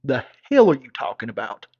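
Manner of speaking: loud, offended outburst; outraged shout. Retold or imitated. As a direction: blow up at her. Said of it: "I'm like".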